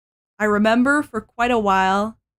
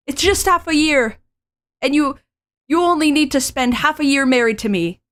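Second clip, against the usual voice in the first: high, louder pleading heroine; anime heroine voice; 6 semitones higher, 5 dB louder than usual. Acted. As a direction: press the offer eagerly. Female lead pleading.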